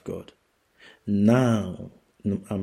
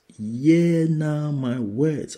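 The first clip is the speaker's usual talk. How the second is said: high, slow proclamation with a rising end; preaching; 5 semitones higher, much slower than usual. Acted. Preacher repeating the Lord's words, slow and rising. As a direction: repeat it slowly, ending up.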